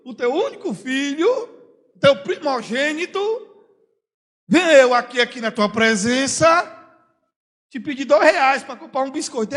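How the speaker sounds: high, loud, overly formal plea; a child asking with absurd ceremony; mock formal son. Preacher mocks a son asking his father for money too formally, high and pompous. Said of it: "o que é que ele diz?".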